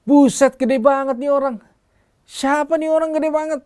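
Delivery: imitated high-pitched kid shouting; loud child's yell. Sunday school boy yells at the huge visiting speaker, loud high shout. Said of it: "dia teriak dengan keras".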